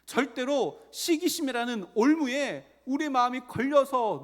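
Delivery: high, loud emphatic exhortation; forceful preaching. Real, not acted. Preacher warns congregation against jealousy's trap, impassioned forceful exhortation.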